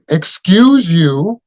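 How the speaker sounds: high, slow, drawn-out "Excuse you" with a rising tune; mock the indignant lady, stretching it out; a haughty stranger scolding someone who brushed past; snooty old lady. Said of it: "And she".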